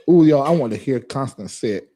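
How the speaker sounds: normal talking voice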